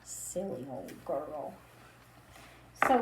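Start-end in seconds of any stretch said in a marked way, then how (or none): 0.0-1.5 soft, slow fond remark; gentle affectionate murmur; tender. Acted. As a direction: say it fondly and quietly. Tender remark on napping lady.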